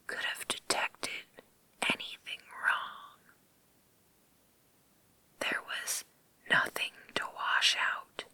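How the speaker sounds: ordinary delivery, nothing marked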